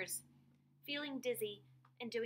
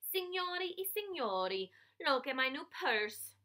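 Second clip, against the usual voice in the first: playful shout as a fancy lady, higher; 4 semitones higher, 4 dB louder than usual. A narrator's reading. Girl showing off a found purse, playful shout. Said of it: "I shouted".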